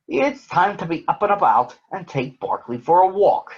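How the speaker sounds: a hint of a busy sleuth; Sherlock voice. Sherlock character voice.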